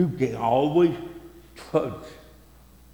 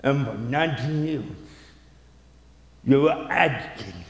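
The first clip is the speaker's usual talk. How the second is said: much softer, lower, consoling voice; tender and gentle; 3 semitones lower, 4 dB quieter than usual. Retold or imitated. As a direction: speak softly to a hurting wife. Husband gently explains wife's pain.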